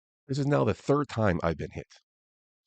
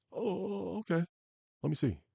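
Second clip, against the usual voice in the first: high, soft mock voice; imitated sheepish reply; 11 semitones higher, 6 dB quieter than usual. Other driver told his insurance expired, mimicked high and soft. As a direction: mimic someone meekly caught out, small and high.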